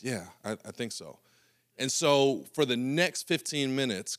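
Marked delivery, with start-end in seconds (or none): 0.0-1.2 softer mumbled yes; meek, unconvinced answer; meek reply. Retold or imitated. Chris answering coach, softer and meek. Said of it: "he says".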